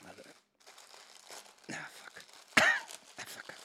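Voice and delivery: breathy whispered fuck; whispered curse. Real, not acted. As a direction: hiss it under your breath. Struggling to open the package.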